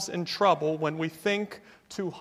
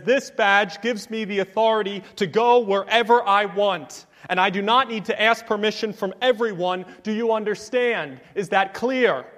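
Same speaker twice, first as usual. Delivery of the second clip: louder, higher, indignant authority; mock furious; 4 semitones higher, 5 dB louder than usual. FBI agent showing his badge to a farmer, mock furious. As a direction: lay down the law, acting angry. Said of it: "responded furiously and said".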